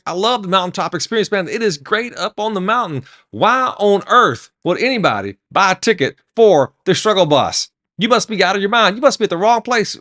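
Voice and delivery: gush, then question him in disbelief; acted excitement rising into bafflement; excited passenger. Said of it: "He's all excited".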